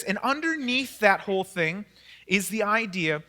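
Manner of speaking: ordinary talk, nothing marked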